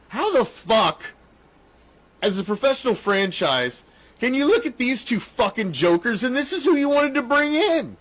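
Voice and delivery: high-pitched, incredulous rant at the team; exasperated outburst. Acted. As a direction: voice rising in disbelief. Acts out an exasperated fan's reaction.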